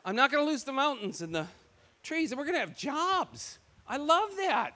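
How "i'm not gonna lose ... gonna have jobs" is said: high, bright burst of joy; excited gush. Retold or imitated. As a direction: gush like a kid who just got good news. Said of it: "I'm like".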